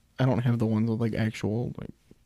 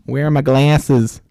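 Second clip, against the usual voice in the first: loud, slow, put-on nerd voice; nerdy kid voice; 2 semitones higher, 9 dB louder, much slower than usual. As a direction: play the asthmatic nerd kid. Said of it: "he's like".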